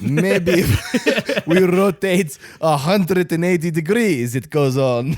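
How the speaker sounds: put-on loud, slow Russian accent; slow, loud Russian-accented worker. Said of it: "Where this just Russian accent".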